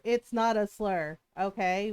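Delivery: slow, firm correction in own voice; firm rebuttal. Real, not acted. Answering people who call the term a slur, firm rebuttal. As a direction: shut the complaint down, slowly and plainly.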